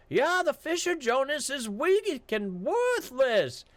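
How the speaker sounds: high, slow, taunting guard voice; mocking guard. Guard stonewalling Jonas at the gate, high and taunting. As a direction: stall him with fake ignorance.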